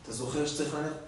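understated father asking his son softly; soft old father. Retold or imitated. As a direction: play the quiet gentle father. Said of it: "אבא אומר לו".

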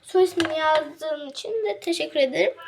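usual speaking voice

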